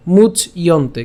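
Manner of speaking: "মুচ ইয়ন্তে" slowly in two parts; syllable-split pronunciation. Shown as a demonstration. Teacher breaks down the word, split "মুচ ইয়ন্তে". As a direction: halt on the ch of "মুচ ইয়ন্তে", then say iyonte. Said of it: "উচ্চারণটা করার সময় কীভাবে করছি".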